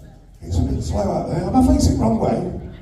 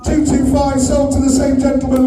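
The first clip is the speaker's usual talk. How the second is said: higher-pitched, projected announcement to the room; auctioneer's sold call; 5 semitones higher than usual. Real, not acted. Auctioneer closing the sale to the room, rousing. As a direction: call the sale and rally the applause.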